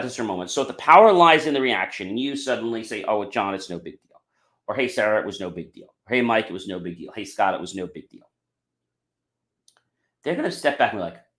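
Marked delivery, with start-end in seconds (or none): 3.0-8.3 soft, easygoing "no big deal"; reassuring forgiveness. Retold or imitated. Forgiving friends after a mistake, soft reassurance. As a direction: gently let them off the hook.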